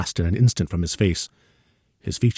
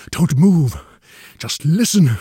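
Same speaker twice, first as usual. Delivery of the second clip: urgent command; 9 semitones higher, 7 dB louder, slower than usual. A narrator's reading. Urgent orders before visitor enters.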